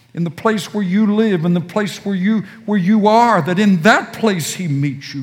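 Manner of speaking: urgent sermon delivery; fervent preaching. Acted. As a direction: drive the point home at speed. Preaching at full force.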